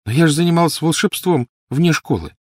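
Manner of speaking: put-on high-pitched child's protest; worried girl. A narrator's reading. Lily worrying about magic outside school, protesting. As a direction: object like an anxious little girl.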